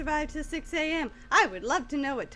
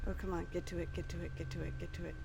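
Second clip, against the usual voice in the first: whispering; 6 semitones lower, 11 dB quieter, much faster than usual. Real, not acted. Urging under breath.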